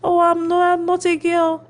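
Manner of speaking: put-on teased nephew insisting he is not a girl, high pitch; imitating nephew. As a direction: whine it like an offended little boy. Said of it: "He'll be like".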